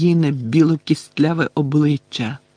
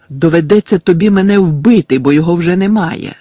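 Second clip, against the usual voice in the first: loud, raised-pitch defiance; Harry shouting; 4 semitones higher, 8 dB louder than usual. A narrator's reading. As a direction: shout back forcefully. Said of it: "закричав Гаррі". (гучний зухвалий крик)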